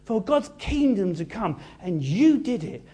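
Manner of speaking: high, bright joyful declaration. Acted. Jesus rejoicing over his disciples, joyful and high. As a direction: speak it filled with joy. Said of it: "he's filled with joy".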